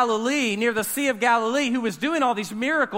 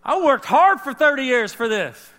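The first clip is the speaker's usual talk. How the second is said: louder, higher swaggering claim; boastful proclamation; 6 semitones higher, 5 dB louder than usual. Retold or imitated. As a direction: crow about finally getting his moment. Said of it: "Jesus would be like".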